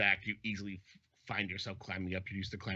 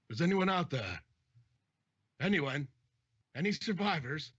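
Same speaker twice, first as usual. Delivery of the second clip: high, raised call into the distance; captain yelling afar; 7 semitones higher, 5 dB louder than usual. Acted. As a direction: call out for survivors. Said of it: "probably yelling over quite a distance".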